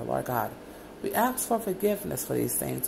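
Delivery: ordinary delivery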